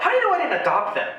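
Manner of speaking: indignant high retort. Retold or imitated. Thinking back at staring strangers, a high indignant question. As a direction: protest it up high and fast. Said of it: "I'm thinking to myself".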